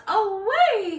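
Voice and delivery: loud, high rallying call; heroic cry. Acted. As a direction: cry out like a knight charging off. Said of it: "he cried".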